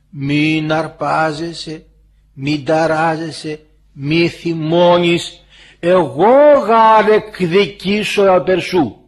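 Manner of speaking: very slow, drawn-out words; solemn admonition. Acted. God tells the believer not to anger, solemn and slow.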